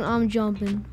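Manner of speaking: usual speaking voice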